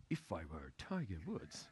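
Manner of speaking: lightly voiced golfer trying on a new idea; imitating Faldo. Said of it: "he stands like this".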